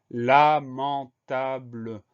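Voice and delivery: "lamentable" broken into la, man, table, very slowly; "lamentable" spaced out, loud first syllable. Shown as a demonstration. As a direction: dictate "lamentable" in drawn-out, separate syllables. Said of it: "Première syllabe".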